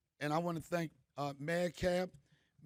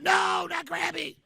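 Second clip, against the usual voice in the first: put-on high, loud, slow kids' cry of protest; mock wailing plea; 11 semitones higher, 8 dB louder, slower than usual. Kids to the aunt, a high drawn-out wailing plea. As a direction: wail like a kid losing a pet. Kids begging for their crab.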